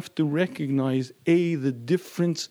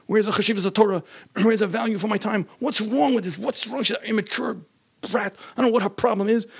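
raised, irritated complaint; indignant fuming; 6 semitones higher than usual. Retold or imitated. Husband complaining in the car on his way home, raised and irritated. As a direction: grumble your outrage.

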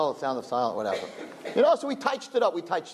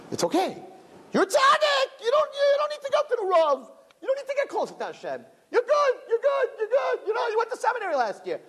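the father's voice. Retold or imitated. The guf father telling the girl she needn't grow, high and coaxing. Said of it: "The father kept saying".

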